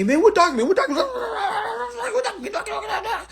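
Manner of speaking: very high squealed babble, run together; hammed-up frantic whining, words dissolving into gibberish; mocking pestering questioners. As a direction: mimic a clamoring crowd in a silly squeal. Mimics followers pestering him with questions.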